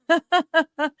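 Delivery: quick high laugh; high chuckle. Real, not acted. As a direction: chuckle at the punchline. Laughs at own joke.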